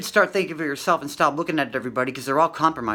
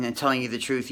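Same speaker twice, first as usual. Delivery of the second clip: normal talking voice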